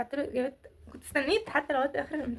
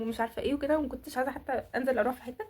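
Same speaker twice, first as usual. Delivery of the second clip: ordinary talk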